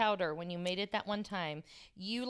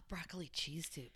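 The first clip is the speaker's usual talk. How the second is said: normal talking voice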